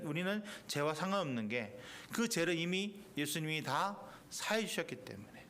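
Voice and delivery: normal talking voice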